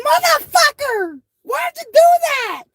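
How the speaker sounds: very high, slow mock mama outrage; imitating mama. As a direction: squeal it like a furious mom. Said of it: "She said it just like that".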